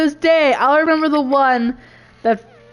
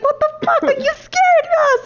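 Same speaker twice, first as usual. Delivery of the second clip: much higher, laughing half-shout; startled outburst; 14 semitones higher than usual. Retold or imitated. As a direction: yelp it like you just got scared in the car. Retelling yelling at startled friend.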